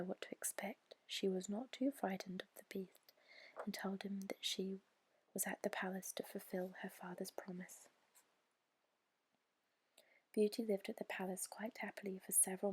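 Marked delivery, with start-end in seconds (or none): none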